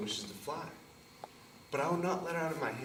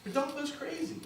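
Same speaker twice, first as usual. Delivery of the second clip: mocking relatives; 11 semitones higher than usual. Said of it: "they would say".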